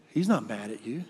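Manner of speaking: ordinary talk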